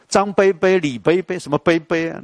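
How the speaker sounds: high, playful 杯杯 for 伯伯; mock a mainland friend saying 杯杯 cutely; imitating mainland accent. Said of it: "外省的朋友在练啊". (学外省腔撒娇念杯杯)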